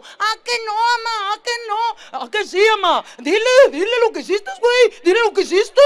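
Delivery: put-on high, loud, fast kid voice egging on mom; a whiny brother shouting to mom to tell on him; bickering kid. Hermanito peleando, acusando. (niño agudo y gritón que pelea con su hermano)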